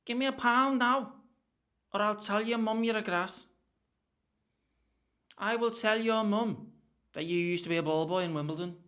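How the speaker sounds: high, loud hammed-up bully voice; bully threatening to tell his mum, hammed up; school bully. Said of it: "That's a decent accent".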